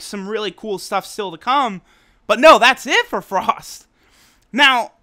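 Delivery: usual speaking voice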